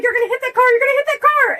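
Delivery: very high, panicked cry; panicked mom. Retold or imitated. Mom curled up in the passenger seat shrieking a high panicked warning. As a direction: shriek the warning like she's terrified. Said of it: "And she was like".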